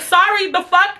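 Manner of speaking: high-pitched mock-indignant apology; sarcastic retold sorry; indignant retort. Acted retort to driver's mask order.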